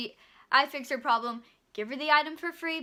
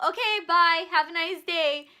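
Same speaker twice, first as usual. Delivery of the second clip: higher and louder fake-cheerful farewell; dismissive goodbye; 4 semitones higher, 9 dB louder than usual. Cashier dismisses yelling customer, bright and loud. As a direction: brush her off cheerfully. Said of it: "all I'm saying".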